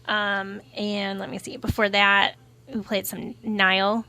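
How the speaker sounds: normal talking voice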